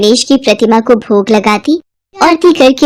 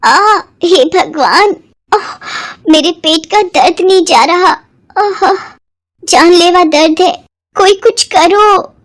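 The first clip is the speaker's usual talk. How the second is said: higher, slow pained moaning; 7 semitones higher than usual. Acted. Stepmother begging her family for help, higher, slow pained moaning. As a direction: groan it out like the pain is unbearable.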